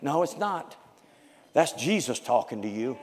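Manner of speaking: normal talking voice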